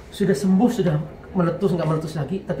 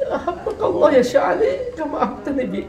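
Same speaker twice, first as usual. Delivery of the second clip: tearful prayer; 10 semitones higher than usual. Real, not acted. Emotional quoted prayer to Syekh.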